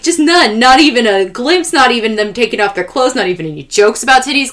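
high, loud outraged rant, imitating dad; enthusiastic dad. Dad complaining to Jesse about a chaste anime, enthusiastic indignation. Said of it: "he was so enthusiastic about".

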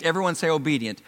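usual speaking voice

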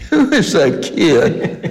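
slow, laughing through the question; mock the puzzled old writers while laughing; amused, drawn-out quoted question; laughing quote.

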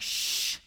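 drawn-out voiceless "shh"; hushing shush. Retold or imitated. Jesus comes up to the freaking-out disciples, hushing them. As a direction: hush them with a long breath of sound.

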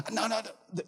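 high, soft protective pleading; imitated hurt kid. Hurt kid warding off a parent's hand, soft high pleading. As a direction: gently fend off a touch like a child.

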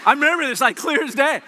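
laughing loudly through the words; high, loud laughing recollection. Real, not acted. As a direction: laugh as you remember it. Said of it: "laugh heard".

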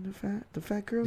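hushed, voiceless mock-secret; whisper. Host playing along with the ASMR joke, whispering. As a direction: whisper it right into the mic. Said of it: "that whispering".

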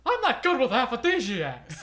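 high put-on cartoon voice; Simpsons quote. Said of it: "It's from The Simpsons".

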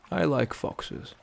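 slow, distant musing; absent-minded. Acted. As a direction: say it lost in thought. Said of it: "Bracknell said absent-mindedly".

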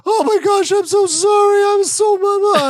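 3 squeak it out high like a flustered wife saying sorry; 1 imitating his wife. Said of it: "she comes out, she's like".

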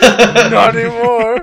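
slow loud laughing yell; laughing shout. Real, not acted. Echoing the shout.

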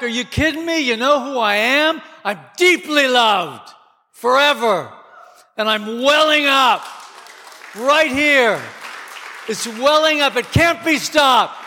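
a high, exultant retort; defiant joy. Acted. Preacher voicing the believer answering critics, high exultant defiance. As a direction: answer the critics with triumphant confidence. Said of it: "we just say".

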